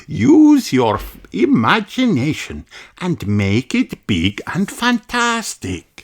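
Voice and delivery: teacher Madame Gazelle. Acted. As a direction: encourage the children gently. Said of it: "says Madame Gazelle".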